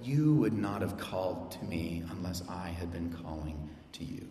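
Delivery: low, soft and solemn; solemn lion voice. Retold or imitated. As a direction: speak it gently as the great lion. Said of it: "and I quote".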